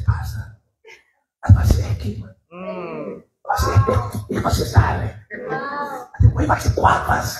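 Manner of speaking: hushed unvoiced confiding; whisper. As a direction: whisper it to the pastor. Whispered confession to pastor.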